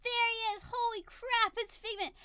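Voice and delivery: high, loud fangirl exclamation; reenacted thrilled outburst; starstruck excitement. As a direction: cry out as your idol appears. Reliving Figment's entrance.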